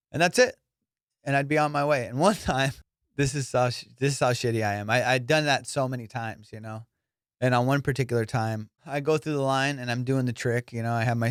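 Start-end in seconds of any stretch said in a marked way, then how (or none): none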